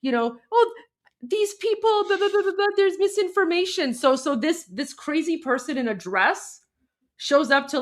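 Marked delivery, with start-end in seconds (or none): 0.5-3.9 high, put-on mocking voice; a high, sneering parody of the man; mocking impression. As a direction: parody him high and dismissive. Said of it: "this is where he says".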